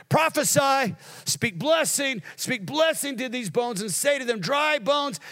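high, ringing prophetic command; full-force preaching. Real, not acted. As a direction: cry it out to rouse the bones.

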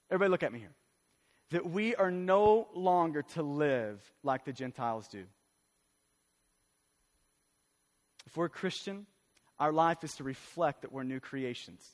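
low, hushed pastoral appeal; solemn preaching. Real, not acted. Pastor asking everybody to look at him, solemn low preaching. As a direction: drop the voice and let each phrase land.

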